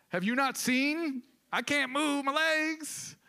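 high, slow, desperate plea; a high, drawn-out cry of a helpless man; pleading paralyzed man. Retold or imitated. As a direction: plead like a man who can't walk.

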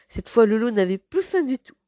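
plain reading voice

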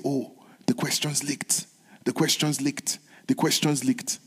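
hushed rumour of leaked questions; soft gossiping murmur; retold whispers. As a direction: pass it on under the breath. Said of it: "there were whispers".

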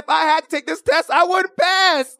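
high, amused outburst, stretched at the end; laughing confession. Real, not acted. Host confessing to listeners about the test, laughing confession.

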